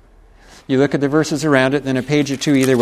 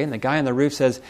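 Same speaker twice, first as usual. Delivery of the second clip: normal talking voice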